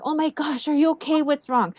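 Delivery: high, startled worried questions; reacting to a friend suddenly crying; alarmed concern. To her crying friend, high startled concern. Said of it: "And I was like".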